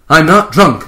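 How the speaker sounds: loud, insulted denial; a captain insisting he's sober; indignant outburst. Acted. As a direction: bark it back at him, offended. Kirk denies being drunk.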